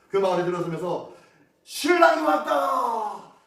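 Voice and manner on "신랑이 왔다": loud, high, drawn-out call; heralding shout. Retold or imitated. Groom's friends announcing his arrival to the village, long shout. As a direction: cry it out to the whole village. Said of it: "친구들이 막 소리를 칩니다".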